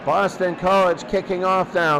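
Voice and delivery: ordinary talk, nothing marked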